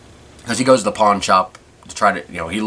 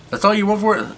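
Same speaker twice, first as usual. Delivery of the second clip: high quoted voice; 12 semitones higher, faster than usual. Retold or imitated. Pawn shop guy quoted in story.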